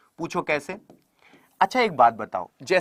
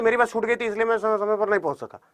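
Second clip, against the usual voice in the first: rushed reading; 4 dB quieter, faster than usual. Shown as a demonstration. Teacher imitating a student reading too fast. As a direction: read it quickly without pauses. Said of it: "आप कुछ लोग इसे पढ़ेंगे तो".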